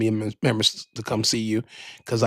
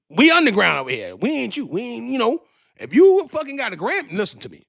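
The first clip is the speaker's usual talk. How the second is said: high, loud rant; heated rant; 8 semitones higher, 4 dB louder than usual. Real, not acted.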